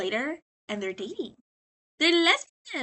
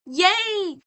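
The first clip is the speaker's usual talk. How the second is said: high, loud little yay; hammed-up delight; mock cheer; 7 semitones higher, 6 dB louder than usual. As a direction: cheer like a kid handed cash. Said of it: "He's like".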